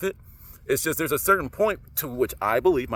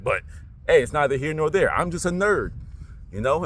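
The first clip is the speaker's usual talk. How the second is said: normal talking voice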